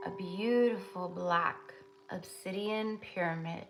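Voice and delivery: soft, steady meditation voice; guided meditation. Acted. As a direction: lead them gently into the vision.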